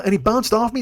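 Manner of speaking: normal talking voice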